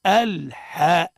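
الحاء slow, with a full open "aa"; deliberate, drawn-out "al-haa" for الحاء; correct open fatha. Shown as a demonstration. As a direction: stretch الحاء, pure a with no tilt to e. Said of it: "الصواب هو".

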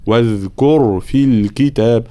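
slow recitation, واذكر with a buzzing, flowing "dh"; correct voiced dhal. Shown as a demonstration. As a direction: recite واذكر measuredly, voicing the ذ fully. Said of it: "وصفة الجهر".

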